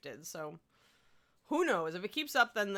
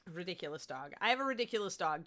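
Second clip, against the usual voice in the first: ordinary talk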